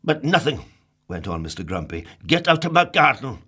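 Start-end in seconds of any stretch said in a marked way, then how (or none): 0.0-0.6 grumpy. Acted. Grumpy cutting Happy off. 2.3-3.4 louder, higher angry command; loud cross "get out"; grumpy order. Acted. Grumpy ordering Happy away.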